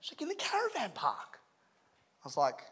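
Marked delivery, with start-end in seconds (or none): 0.2-1.4 high, hushed wife voice; pitched way up and soft, as the wife; imitated wife. Wife answering where they would shower, imitated high voice.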